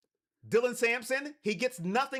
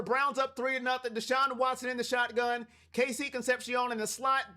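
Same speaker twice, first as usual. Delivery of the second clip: usual speaking voice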